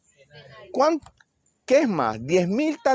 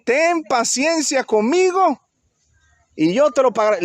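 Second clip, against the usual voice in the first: begging plea; 9 semitones higher, 3 dB louder than usual. Acted. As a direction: beg for patience, stretching out conmigo. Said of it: "Le rogaba diciendo".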